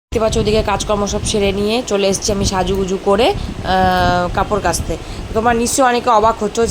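ordinary delivery

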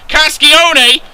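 high, loud cry; excited name call. Real, not acted. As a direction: shout the player's name as he runs. Calling out player's run.

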